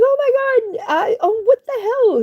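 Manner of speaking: very high, frantic outburst; freaking out. Retold or imitated. Driver shocked someone threw a kitten, high panic. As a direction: squeal it in panicked disbelief. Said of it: "I was freaking out".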